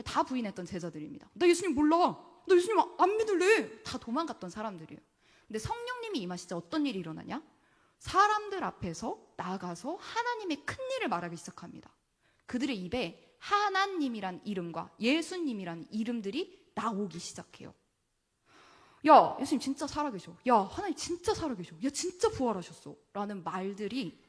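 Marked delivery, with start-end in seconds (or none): 1.3-3.8 loud, high scoffing denial; denying disciples. Acted. Preacher voicing disciples who deny Jesus, loud and scornful. 19.1-23.0 loud, thrilled announcement; excited proclaiming. Acted. Disciples telling people Jesus lives, loud and thrilled.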